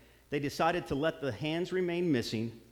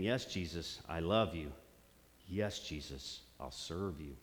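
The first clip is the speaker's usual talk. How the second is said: soft prayer; 8 semitones lower, 7 dB quieter than usual. Shown as a demonstration. Prayerful commitment, getting softer.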